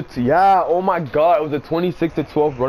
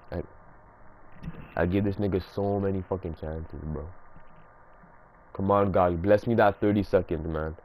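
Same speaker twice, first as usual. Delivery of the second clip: much lower and softer, grumbling to himself; seething under his breath; low fuming mutter; 10 semitones lower, 7 dB quieter than usual. Real, not acted. Waiting on paused clock, fuming.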